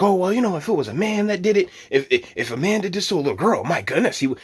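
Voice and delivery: mock-indignant retold objection; imitating aggrieved guys. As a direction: parody the whining commenters, quick and high. Mimicking complaining guys online.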